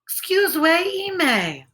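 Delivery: high squealy pig-talk excuse me; Pigland speech. Acted. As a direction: say it like a pig would. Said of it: "And in Pigland".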